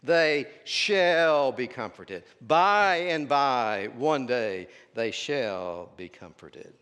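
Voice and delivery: slow, booming "shall", easing off by the end; stress on shall. Acted. Preacher voicing an objector who reads it as future tense, drawn-out "shall". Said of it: "And you say, But wait a minute".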